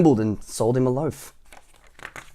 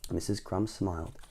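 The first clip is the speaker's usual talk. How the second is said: plain reading voice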